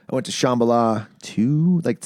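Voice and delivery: ordinary talk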